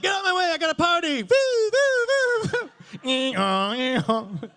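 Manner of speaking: high, loud whooping ambulance; party siren. Pretend. Party ambulance clears traffic with high loud woo-woo calls. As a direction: yell your way through traffic to a party. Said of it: "I always feel like".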